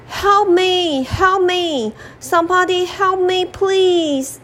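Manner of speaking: high, desperate cries for help; a trapped lion calling to all the animals; lion shouting for help. Acted. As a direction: call out for help, trapped and scared. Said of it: "shouted the lion".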